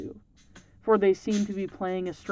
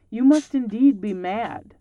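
louder, incredulous outcry; exclaimed; 3 semitones higher, 7 dB louder than usual. A narrator's reading. Old woman tells the stranger he is mad, exclaiming. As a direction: cry it out in disbelief. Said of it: "she exclaimed".

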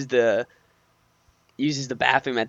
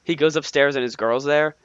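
ordinary delivery

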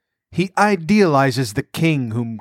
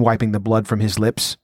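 ordinary delivery

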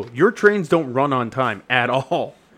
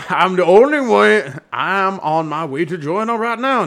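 put-on comic clergyman accent; hammed-up Doctor Chasuble; 3 semitones higher, 3 dB louder than usual. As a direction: play a theatrical reverend.

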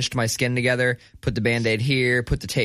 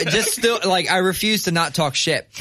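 usual speaking voice

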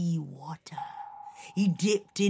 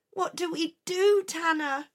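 put-on higher childlike worried question; high girl voice; 11 semitones higher than usual. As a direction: ask like a little sister. Little sister asking.

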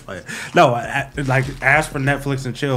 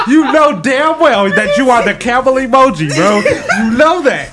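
loud laughing taunt; laughing teasing; 7 semitones higher, 4 dB louder than usual. Real, not acted. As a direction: tease him through laughter. Teasing friend he's the camel.

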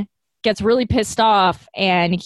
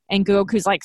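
usual speaking voice

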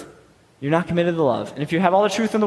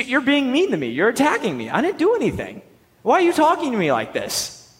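mock-hurt, high-pitched defensive whine; whiny protest; 4 semitones higher than usual. Teen athlete protesting to a harsh coach, whiny. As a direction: complain like a picked-on kid, pitch swooping. Acting out his younger, defensive self.

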